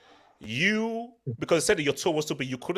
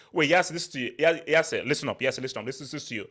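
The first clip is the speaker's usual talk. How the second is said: ordinary delivery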